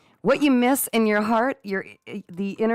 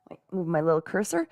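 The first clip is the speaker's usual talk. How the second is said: normal talking voice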